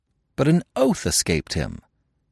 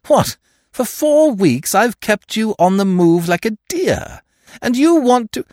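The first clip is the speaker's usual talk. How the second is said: much higher, louder indignant shout; furious outburst; 11 semitones higher, 6 dB louder than usual. A narrator's reading. Inspector exploding at the thief who fooled him, furious shout. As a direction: blow up in outrage.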